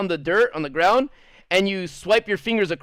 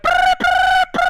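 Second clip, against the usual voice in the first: high shrill imitated whistle blasts, loud; 6 dB louder, slower than usual. Teacher breaking up the fight, loud high whistle imitation. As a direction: mimic a teacher's whistle with the lips. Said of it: "blowing the whistle".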